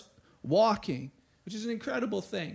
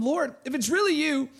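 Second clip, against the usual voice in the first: higher, raised calling voice; 8 semitones higher, 4 dB louder than usual. A narrator's reading. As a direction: call across the water to someone. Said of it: "Peter called to him".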